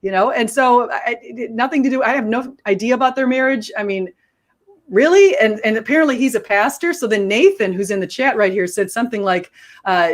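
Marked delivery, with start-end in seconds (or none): 4.9-5.4 higher, louder disbelieving question; can't-believe-it rising question; incredulous. Retold or imitated. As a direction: push it up sharply in disbelief. Incredulous at the husband's accusation.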